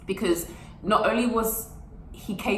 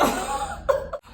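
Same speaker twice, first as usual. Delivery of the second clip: breathy laugh; 3 dB quieter than usual. Real, not acted. Laughing at her own story.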